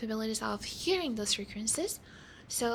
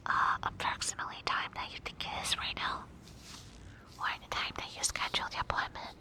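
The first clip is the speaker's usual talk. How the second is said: whisper. Pretend. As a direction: whisper gently as if leaning in close. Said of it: "whispering part".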